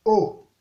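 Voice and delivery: Haut short, dropping low. Shown as a demonstration. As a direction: drop Haut briefly to the bottom. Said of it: "Ton bas". (« Haut » au ton bas)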